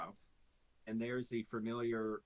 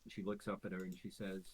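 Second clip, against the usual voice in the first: ordinary talk, nothing marked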